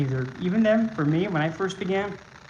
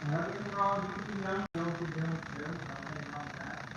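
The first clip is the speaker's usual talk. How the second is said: ordinary delivery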